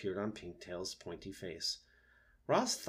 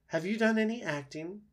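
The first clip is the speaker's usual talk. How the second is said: opossum voice; 12 semitones higher, 4 dB louder than usual. Acted. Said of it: "said the opossum".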